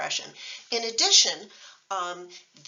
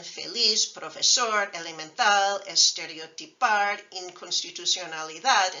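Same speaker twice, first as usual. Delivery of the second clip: feliz, profesor, elemental, estereotipar, inconstitucionalidad, each hit on the last syllable; last-syllable stress; 3 dB louder than usual. Shown as a demonstration. Teacher listing consonant-final words, last-syllable stress. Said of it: "regular stress falls on the last syllable".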